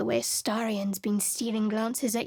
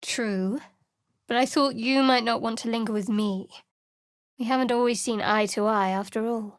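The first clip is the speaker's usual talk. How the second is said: usual speaking voice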